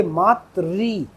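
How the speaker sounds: मातृ in two slow parts, ending "trii"; slow, deliberate "maa-tri" for मातृ; correct pronunciation. Shown as a demonstration. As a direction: draw मातृ out as "maat-rii". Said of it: "हम इसे मात्र नहीं पढ़ सकते".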